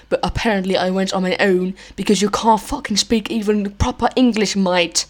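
hammed-up British "mate" talk, indignant; fake British accent. Podcaster scolding co-host's English, mock-indignant British accent. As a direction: rant like a badly faked Brit. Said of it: "finish off with a nice British accent".

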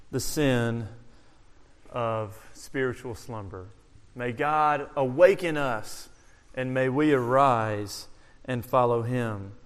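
hushed prayer. Real, not acted. Preacher praying for his flock, low and hushed. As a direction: pray it quietly over the people.

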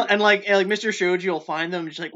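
normal talking voice

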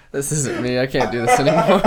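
laughing through a fake breakdown; badly faked serious confession, breaking into laughter; mock confession. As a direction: blurt it out, cracking up.

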